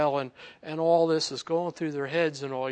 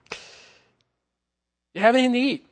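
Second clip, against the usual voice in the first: higher and louder, bright question; Jesus asking for food; 9 semitones higher, 8 dB louder, much faster than usual. Acted. Jesus asks the stunned disciples for food, higher and louder. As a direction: pop the question eagerly.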